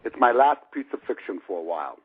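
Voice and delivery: ordinary delivery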